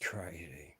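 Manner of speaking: breathy whispered aside to himself; a whispered "Crazy" under the breath. Real, not acted. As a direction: drop to a whisper, shaking your head.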